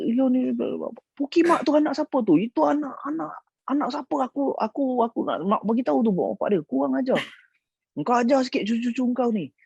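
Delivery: high, cursing demand; mock angry old man. Great-uncle bursts in demanding whose kids, high mock anger. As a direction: scold like an outraged old man. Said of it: "dia cakap".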